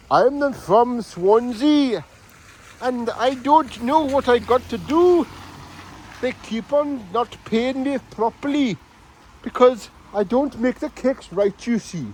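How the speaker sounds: lilting mock Welsh accent with a sing-song tune; a comic Welsh baker's lilt. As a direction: put on a hammed-up Welsh voice from Swansea. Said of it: "That's a Welshman".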